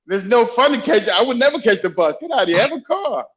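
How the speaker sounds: normal talking voice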